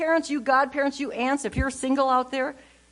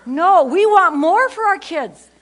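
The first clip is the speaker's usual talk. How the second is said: ordinary talk, nothing marked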